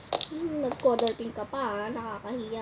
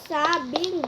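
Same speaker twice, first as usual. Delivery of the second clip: usual speaking voice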